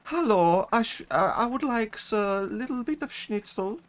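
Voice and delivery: very high, hammed-up German tourist; put-on high German voice; mock German accent. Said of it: "go to Germany and just talk like that".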